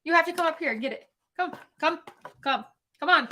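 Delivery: high coaxing call to a pet; talking to a dog. Real, not acted. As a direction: coax the dog up, sing-song high. Calling her dog over.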